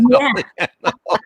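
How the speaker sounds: high, bright burst of laughter; remembering startling David, a high delighted laugh. Real, not acted. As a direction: laugh out at the memory of whooping. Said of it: "I literally whooped".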